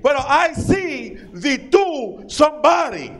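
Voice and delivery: put-on drawn out, eager Spanish accent; hammed-up Spanish accent. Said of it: "But somebody in here".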